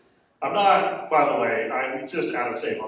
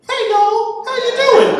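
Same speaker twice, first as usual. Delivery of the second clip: very high, loud cartoon Southern voice; talking sweet tea; 9 dB louder, slower than usual. Sweet tea greeting whoever takes a sip, high cartoon voice. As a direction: squeal a hammed-up Southern hello. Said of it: "that tea just goes".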